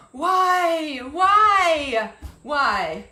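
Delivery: loud, high cry of frustration; exasperated why. Real, not acted. Venting to live viewers, she cries out loudly. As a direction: throw the word up at the sky in exasperation.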